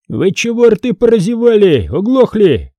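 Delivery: high, indignant rebuke; high-pitched impatient demand; acted scolding. Foma scolds the gaping soldiers.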